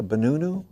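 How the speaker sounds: banana said as "banunu", with oo vowels; silly oo-vowel "banunu"; altered vowels. Shown as a demonstration. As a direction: say "banunu" with oo in place of each stressed a. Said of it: "Those sound like interesting fruits".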